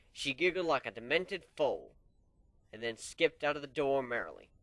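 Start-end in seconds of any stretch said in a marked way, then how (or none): none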